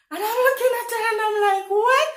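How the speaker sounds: high, rushed squeal into a loud rising question; incredulous. Retold or imitated. Reacting to a question about her origins, incredulous. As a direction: race up to an astonished yelp. Disbelief at customer's question retold.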